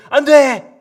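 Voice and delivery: loud, high cry of no; shouting stop. Acted. David shouting to stop his soldier. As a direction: shout to stop him at once.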